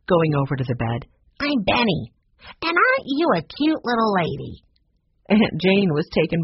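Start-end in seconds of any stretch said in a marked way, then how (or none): 1.4-4.6 put-on higher, eager young boy voice; little boy Benny. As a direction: chirp it like a cheeky little boy. Small boy Benny greets aunt.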